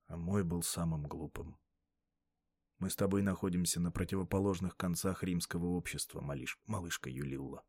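low, soft man's voice; weary grown man, Sulla. Acted. Voices Sulla talking to the girl. (низкий, тихий мужской голос)

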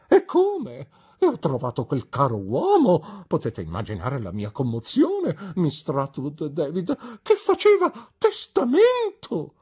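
high, loud gushing, peaking on "testamento"; theatrical dowager relishing the news; excited old lady. A narrator's reading. Mother gushes to the guests about the will. Mrs McLean voiced.

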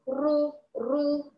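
رو drawn out long with a heavy back R; مد الراء. Shown as a demonstration. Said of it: "أما أرتفع بأقصى اللسان". (رو ممدودة مفخمة)

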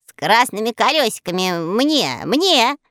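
put-on grabby childish call; eager shout. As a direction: claim it eagerly. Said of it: "закричал Мурзилка". (нетерпеливо)

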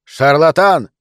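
loud one-word outcry; shouted accusation. A narrator's reading. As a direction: cry it out as an accusation. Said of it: "Он сделал паузу и выкрикнул".

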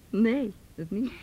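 laughing no; amused burst. Acted. Laughs at the idea.

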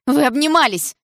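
high, loud jealous outburst; jealous accusation. A narrator's reading. Jealous heroine accusing him of hugging his ex, high loud outburst. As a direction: accuse him, pitch leaping up. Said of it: "ревность снова сдавила горло".

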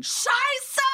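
very high, loud shrieked Scheiße; shrieked curse. Acted. Comic trip sketch, he shrieks a curse at his situation. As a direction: screech it out in comic despair.

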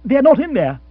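high, loud panicked blurt; agonized cry. Acted. Bill stopping Monica opening the chest, in panic. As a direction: cry out in alarm to stop her. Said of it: "Bill uttered an agonized cry".

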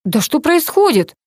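high, bewildered question; a touch of shocked disbelief; stunned. A narrator's reading. Narrator hears of the son in intensive care, stunned. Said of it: "обомлела я".

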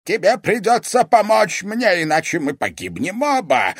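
high, loud, urgent demand; snarling. A narrator's reading. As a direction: snarl it out as a desperate threat. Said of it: "прорычал он".

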